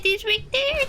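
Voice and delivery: high, squeaky surprised repeat; squeaky surprise. Real, not acted. Streamer repeats the spot in a squeaky surprised voice. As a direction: squeak it out in disbelief.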